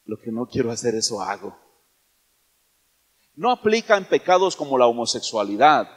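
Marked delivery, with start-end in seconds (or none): 0.1-1.6 much lower, much softer sheepish excuse; mumbled excuse. Pretend. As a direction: mumble it low like a caught sinner. Mocking a sinner's lame excuse.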